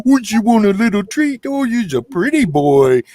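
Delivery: put-on gushing baby voice over the fence to a dog; baby talk to a dog. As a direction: coo at the dog like it's a baby. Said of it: "just talking like that".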